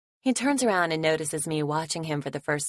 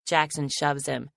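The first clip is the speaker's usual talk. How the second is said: usual speaking voice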